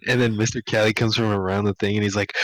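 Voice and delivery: usual speaking voice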